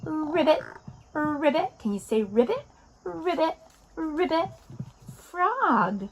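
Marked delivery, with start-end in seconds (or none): 0.0-1.8 higher, rolled frog croak; frog ribbit. Shown as a demonstration. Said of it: "What kind of sound does a frog make?". 3.0-4.5 higher-pitched playful frog call; frog ribbit. Shown as a demonstration. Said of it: "What kind of sound does a frog make?".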